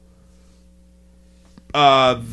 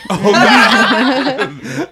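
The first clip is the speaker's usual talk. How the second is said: laughing; 7 semitones higher, 4 dB louder than usual. Real, not acted. Reacting to joke, laughing.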